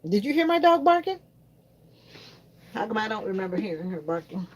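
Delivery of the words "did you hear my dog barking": mock challenge.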